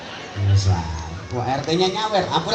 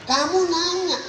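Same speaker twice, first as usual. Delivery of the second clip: mocking catchphrase; 12 semitones higher than usual. Preacher mocks the viral phrase for the congregation, high and teasing. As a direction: sing out "Kamu nanya" high and teasing like the viral clip.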